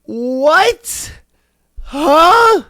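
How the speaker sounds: loud, high rising disbelief; baffled outburst, pitch shooting up; incredulous. Acted. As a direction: blurt it out in total disbelief. Baffled reaction to an absurd quote.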